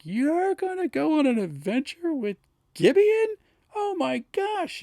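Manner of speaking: high, excited squeal of delight; gleeful wharf manager. Retold or imitated. Wharf manager squealing at the two elves, high and delighted. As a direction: squeal it like a thrilled dockhand. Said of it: "he starts calling this out".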